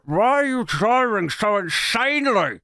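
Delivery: muffled, swollen-mouthed imitation; sore-throat speech. Girlfriend with sore throat in passenger seat, muffled. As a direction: mimic talking round a badly swollen throat. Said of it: "she still can't drink or eat or talk".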